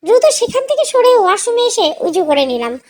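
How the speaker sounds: ordinary delivery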